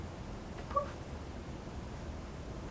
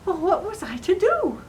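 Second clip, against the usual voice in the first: higher-pitched, distressed wondering; mock despair, pitch lifted; fretful; 7 semitones higher than usual. Queen recalling her predicament to listeners, fretful.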